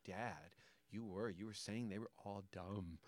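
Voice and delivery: put-on soft, slow child's scolding, lightly done; a hint of a disappointed little girl; lightly voiced reproachful daughter. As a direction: say it slowly and quietly, like a kid correcting dad. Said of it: "And she's like".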